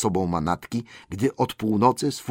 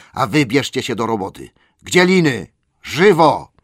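loud, high commanding dwarf; commanding Thorin; 4 semitones higher, 6 dB louder than usual. A narrator's reading. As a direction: bark orders with regained authority. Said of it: "rzekł Thorin, odzyskując godność".

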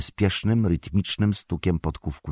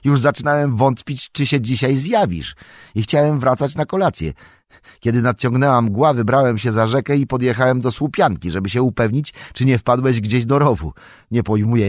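put-on higher, louder, eager young hobbit; young hobbit Merry; 8 semitones higher, 6 dB louder than usual. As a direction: chatter on with cheerful relief. (Mary wita Froda: wysoki, żywy głos młodego hobbita)

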